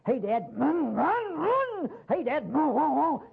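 put-on high, pushy kid voice begging Dad; pestering teenage son. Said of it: "here comes this kid saying".

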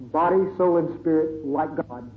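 ordinary talk, nothing marked

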